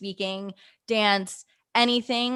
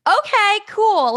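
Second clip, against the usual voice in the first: loud, very high re-enacted reply; high-pitched acceptance; 12 semitones higher, 7 dB louder, much slower than usual. Answering the surprise co-host offer, high and loud. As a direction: squeak it out, trying to play it cool.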